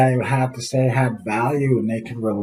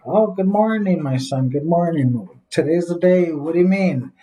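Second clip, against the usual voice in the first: mock surprise; 6 semitones higher than usual. Father plays dumb to son.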